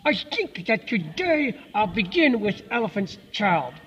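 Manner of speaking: slow, slurred clenched-teeth crocodile; between the teeth. A narrator's reading. Crocodile with the child's nose in his jaws, between his teeth. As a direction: force each word out slowly through clamped teeth. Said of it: "said it like this between his teeth".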